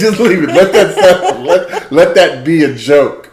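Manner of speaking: laughing while saying let the joke go; laughing through it. Real, not acted. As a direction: keep laughing as you repeat the advice. Still laughing retelling his advice.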